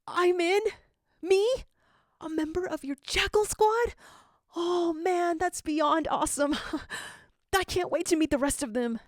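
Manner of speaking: put-on high, bubbling delighted kid; can't contain the joy; thrilled disbelief. Gadget learning he joined the Jackal Squad, thrilled and high.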